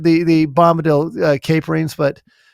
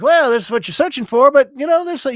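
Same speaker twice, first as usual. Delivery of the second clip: jolly; 7 semitones higher, faster than usual. Shown as a demonstration. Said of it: "If it was said in a jolly way".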